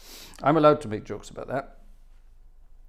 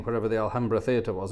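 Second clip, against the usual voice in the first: ordinary talk, nothing marked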